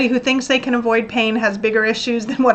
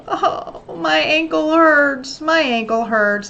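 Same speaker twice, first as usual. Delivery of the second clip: stretched, higher, slow self-pitying whine; mock whining; 4 semitones higher, slower than usual. Sitting down crying over a twisted ankle, whining. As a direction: whine it like a sulky child. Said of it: "cry and whine and say".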